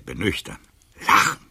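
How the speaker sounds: ordinary talk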